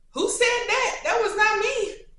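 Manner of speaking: very high, loud squeal of denial; mock disbelief. Disowning own accent while editing videos, high mock disbelief. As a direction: squeal in hammed-up disbelief, loudly.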